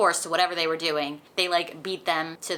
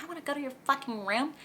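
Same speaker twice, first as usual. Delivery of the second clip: high, fast, sneering mock of the offer; pitched-up scornful parody; sarcastic mock; 8 semitones higher, 5 dB quieter, faster than usual. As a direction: throw the invitation back with scorn. Mocking Violet's refusal.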